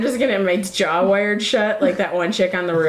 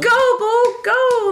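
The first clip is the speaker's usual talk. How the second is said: cheering; 13 semitones higher, much slower than usual. Retold or imitated. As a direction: yell it like a sports parent. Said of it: "I'm like".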